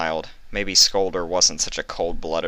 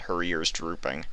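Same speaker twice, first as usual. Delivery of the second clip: ordinary delivery, nothing marked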